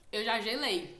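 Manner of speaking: ordinary talk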